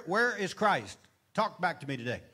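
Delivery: usual speaking voice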